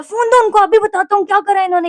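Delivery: high, loud imitation of an indignant dad; protective outrage. Possessive papa threatening to confront staff, imitated angry outburst. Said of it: "मेरे पापा काफ़ी possessive है".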